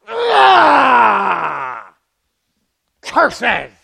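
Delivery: hammed-up cartoon villain cry; a foiled villain, played big; mock villain. Preacher voicing Satan foiled by God, hammed-up cry. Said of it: "And Satan goes".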